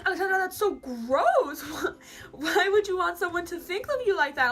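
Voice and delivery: high, loud, scandalized mother; hammed-up outraged squeal; mock disgusted mom. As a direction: imitate a mother scolding in horror. Mimics mom's disgusted scolding.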